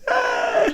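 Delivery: high mock groan of a vague reply, "Ah."; imitated noncommittal noise. Mocking a co-host's answer to a film question, high mock "Ah". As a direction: squeak out a noncommittal "Ah" like a shrug. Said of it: "and you were like".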